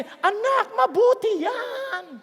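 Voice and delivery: drawn-out, mock approving dad; indulgent father. Father approves of his child's drug use, mock indulgent. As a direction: slowly coo approval as a permissive father. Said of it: "ang tatay mo sabi".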